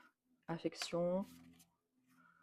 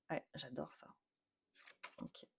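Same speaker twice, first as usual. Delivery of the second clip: soft whisper; 2 semitones lower, 15 dB quieter than usual. Real, not acted. Unvoiced, very soft.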